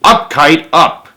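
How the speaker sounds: loud cheer up at the kite; mild shout to the kite. Acted. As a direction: yell it up to the sky. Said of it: "and he shouted".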